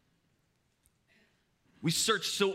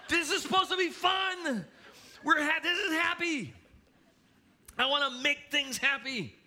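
desperate dad shout; 10 semitones higher than usual. Retold or imitated. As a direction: yell that this is supposed to be fun. Dad over shrieking kids.

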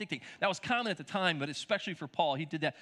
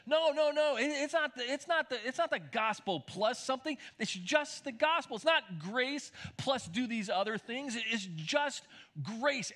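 higher-pitched, insistent objection; upset protest; 7 semitones higher than usual. Retold or imitated. Paul refuting the teachers who came after him, upset. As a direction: act out an upset apostle correcting them. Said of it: "Paul gets so upset about this".

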